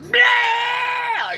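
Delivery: very high, loud scream; hammed-up yell. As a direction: scream it at the crowd. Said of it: "I started, like, screaming at people".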